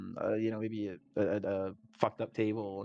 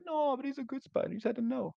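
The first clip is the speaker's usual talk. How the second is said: much higher, sweet protective plea; high pleading voice; 13 semitones higher than usual. Retold or imitated. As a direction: defend the spider like a kid. Defending the good spider.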